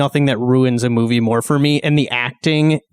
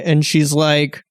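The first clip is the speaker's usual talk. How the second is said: normal talking voice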